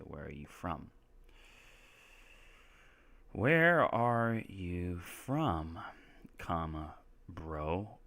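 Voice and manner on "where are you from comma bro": very slow, stretched-out words; drawn-out dictation. Shown as a demonstration. Teacher writing out "Where are you from" for students, very slowly. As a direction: stretch each word as if writing it. Reading aloud while writing it out.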